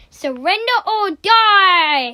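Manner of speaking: loud, slow pirate threat; menacing pirate ultimatum, shouted; cried threat. Acted. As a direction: cry it high, stretching the last word. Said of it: "he cried".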